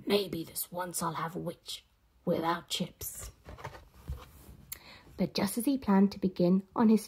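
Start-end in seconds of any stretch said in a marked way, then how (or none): 0.1-3.3 low, soft, slow dragon; gloating dragon. Acted. Dragon decides to eat the witch, low and soft. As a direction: savor the threat quietly.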